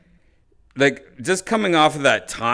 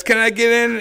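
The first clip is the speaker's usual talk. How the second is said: high, put-on asking voice; lightly acted eager request, pitched up; Jeremiah; 10 semitones higher, 4 dB louder than usual. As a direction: ask to join in with a raised mimicking voice. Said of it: "And he goes".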